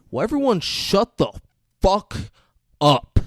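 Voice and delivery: mock fed-up command. Sniper on the ground silencing everyone, mock exasperated. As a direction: break up the order for emphasis.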